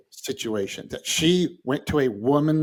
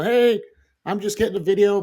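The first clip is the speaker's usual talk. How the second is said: calling out; 8 semitones higher, 4 dB louder than usual. Retold or imitated. Calling out to the homeowner.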